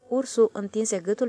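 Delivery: usual speaking voice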